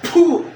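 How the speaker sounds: loud, high "puh"; explosive puff of breath; ritual blowing puff. Real, not acted. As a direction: blow hard to drive the spirit out. Healer blows on the patient.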